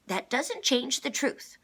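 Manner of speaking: high, loud, slow snarl. A narrator's reading. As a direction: snap back testily. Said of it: "number two snarled".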